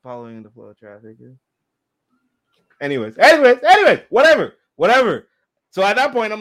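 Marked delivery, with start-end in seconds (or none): 0.0-1.4 very soft, private aside; hushed silent objection, imitated; inner thought. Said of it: "In my head". 2.8-5.3 much louder, higher brush-off; exasperated, repeated cut-off; loud dismissal. Real, not acted. Cutting off own digression, repeated dismissal.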